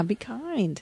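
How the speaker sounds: hushed and drawn out, slow; soft, slow echo of be kind; soft slow plea. Pretend. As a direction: breathe it out gently and slowly.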